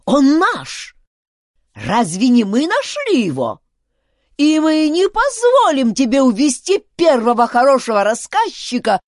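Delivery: high, loud outraged tirade; furious squealing chief; old man yelling. A narrator's reading. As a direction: howl a possessive rant without easing off. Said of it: "завопил старик".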